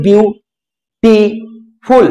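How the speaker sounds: Beautiful very slowly in three parts, বিউ-টি-ফুল, with clear pauses; ভাঙা syllable by syllable. Shown as a demonstration. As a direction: break beautiful into বিউ, টি, ফুল, one beat at a time. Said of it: "ভেঙে ভেঙে উচ্চারণ করতে পারি". (Beautiful শব্দটি ভেঙে ভেঙে, থেমে থেমে উচ্চারণ)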